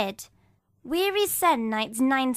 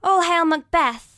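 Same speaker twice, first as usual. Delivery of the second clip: ringing hail; 7 semitones higher, 6 dB louder than usual. Acted. Witches greet Macbeth.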